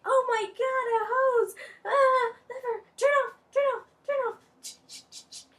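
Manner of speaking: high, loud frantic squeal; acted panic. Student fighting a spraying lab hose, acted panic. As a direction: squeal helplessly at a runaway hose.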